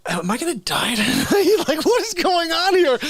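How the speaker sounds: mock panic with a laugh, climbing high; retold nervousness. Musician getting in the gunman's car, retold nervous panic. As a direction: laugh through an acted-out panic. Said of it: "I got nervous".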